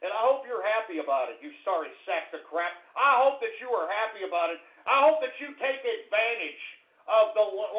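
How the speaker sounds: ordinary talk, nothing marked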